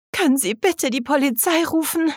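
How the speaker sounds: high, agitated plea; trembling with agitation. A narrator's reading. Katrina begs the stranger to call the police, agitated. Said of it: "fragte Katrina zitternd vor Aufregung".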